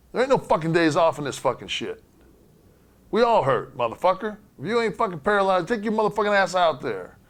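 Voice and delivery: high, loud, furious tirade; furious rant. Retold or imitated. Yoko ripping into Hunter in the bus, furious shouting. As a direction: tear into him at full voice. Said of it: "He says".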